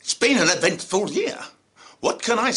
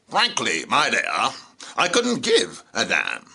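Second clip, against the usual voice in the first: film quote impression; 7 semitones lower than usual. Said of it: "in the words of some of our celebrities".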